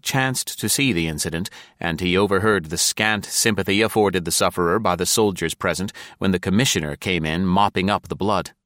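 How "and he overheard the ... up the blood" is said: ordinary delivery, nothing marked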